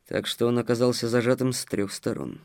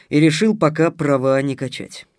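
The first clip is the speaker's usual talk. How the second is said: ordinary delivery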